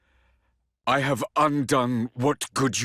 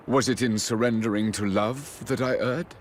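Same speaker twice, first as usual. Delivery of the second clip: ordinary talk, nothing marked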